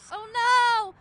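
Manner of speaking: high, loud cry of alarm; frightened cry. Acted. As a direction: cry out in fear. Flower spirits in danger.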